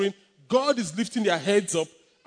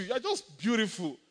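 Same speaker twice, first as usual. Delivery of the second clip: normal talking voice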